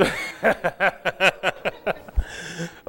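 real laugh at his own joke; breaking up laughing. Real, not acted. Preacher laughing after his lawyer joke, real laughter.

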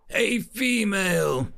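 pointed reveal. Acted. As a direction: make the point land. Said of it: "the educator said".

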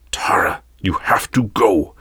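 whispered, intense urgency; urgent whisper. A narrator's reading. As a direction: whisper an urgent order to your apprentice. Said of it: "an intense urgency in his eyes".

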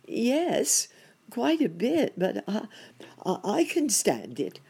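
high, halting brave-face reply; acted timid rabbit in the dentist's chair; anxious patient. A narrator's reading. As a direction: hesitate high-pitched, putting up with pain. Rabbit enduring pain at the dentist.

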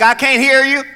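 high, loud call from the back pew; loud heckling elder. Retold or imitated. Older church member heckling the child, loud call. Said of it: "some older person be like".

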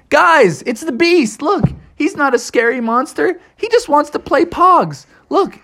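high, reassuring frog call; Froggy the excited frog. A narrator's reading. Froggy introduces the beast to friends, high excited voice.

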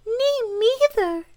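very high, slow mouse squeak; tiny squeaky creature voice; tiny little voice. A narrator's reading. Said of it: "said a tiny little voice".

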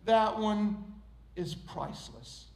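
gentle reply that drops to a hush; smiling potter. Acted. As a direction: say it quietly with a proud smile. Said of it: "the potter smiles and says".

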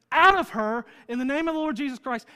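loud, fast commanding rebuke; commanding exorcism. Retold or imitated. Paul rebuking the demon in the girl, loud command. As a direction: cast the demon out with force. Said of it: "and Paul says".

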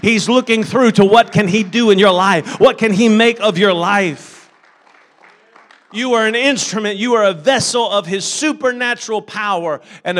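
loud, ringing preacher's call to the congregation; full-force preaching. Acted. Pastor rallying the congregation, loud and fervent. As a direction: preach it out, building the crowd.

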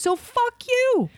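very high, drawn-out mock-furious curse; indignant cursing. Server venting at the customer to her host, comically indignant. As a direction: let it soar in comic outrage.

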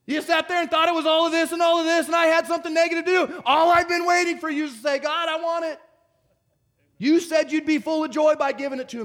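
loud, high, fired-up preaching; full-force preaching. Acted. Pastor preaching hard to the congregation, loud and high. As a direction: preach it at the top of your voice.

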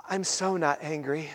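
low, hushed mock denial of anger; faked calm. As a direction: say it very softly, pretending to be calm. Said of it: "if you fake it and be like".

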